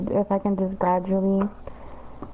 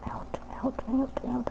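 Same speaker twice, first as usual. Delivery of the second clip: whispered; 3 semitones higher, 9 dB quieter, slower than usual. Real, not acted. Hurrying someone out.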